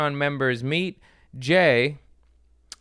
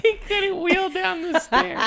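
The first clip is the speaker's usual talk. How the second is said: high, laughing delivery; real laughter, much higher. Real, not acted. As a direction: giggle through the joke. Joking about old neighbor.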